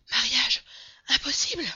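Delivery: high, loud gasped outburst; breathless panic. A narrator's reading. Passepartout breaking news to Mr. Fogg, breathless and frantic. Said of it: "essoufflé".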